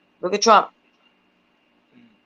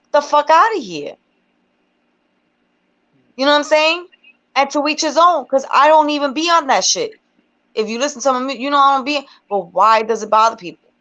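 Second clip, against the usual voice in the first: pitched way up, fired-up venting; heated rant; 7 semitones higher than usual. Real, not acted. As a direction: get worked up and let it fly high. Heated rant about judging women.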